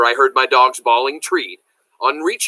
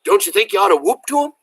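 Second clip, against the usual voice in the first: grandpa's question; 7 semitones higher, faster than usual. A narrator's reading. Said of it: "He said to me".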